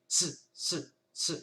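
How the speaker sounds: Ce, ce: s seul coupé net, sans voyelle; stopped s. Shown as a demonstration. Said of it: "Non, on vient stopper". (s sec, sans voyelle)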